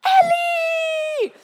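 mother screaming at the poop-filled bathtub; panicked scream. Retold or imitated. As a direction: shriek the baby's name in alarm. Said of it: "So I screamed, I yelled".

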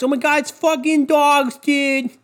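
high gushing dog-lover parody; high mock swoon over "fucking dogs"; mocking squeal. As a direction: squeal it like an infatuated fan. Mocking dog lovers after his point.

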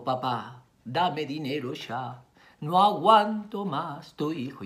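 slow, gentle, pleading delivery; tender plea. Acted. Mother rereads the son's mail to the father, slow tender plea. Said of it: "¿Por qué no me lo pidió así?".

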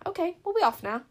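high, businesslike sign-off; brisk. A narrator's reading. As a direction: announce it briskly to get away. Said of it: "said Bodkin briskly".

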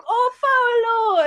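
high, drawn-out swoon over Paolo; adoring lady. Retold or imitated. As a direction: imitate a lady fawning, slow and high. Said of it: "this random lady that gave her a wheel of cheese".

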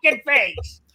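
high, loud Brooklyn shout; swearing tirade. Acted. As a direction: yell the threat back, furious. Judge Judy fighting back.

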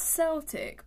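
"Celtic" said slowly as SEL-tik; soft C. Shown as a demonstration. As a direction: start "Celtic" with an S sound, drawn out. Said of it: "Why are they pronounced differently".

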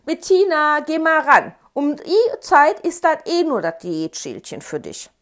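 mother calling loudly from the kitchen; high, very loud call to another room. A narrator's reading. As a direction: holler it through the house. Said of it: "rief Mama aus der Küche".

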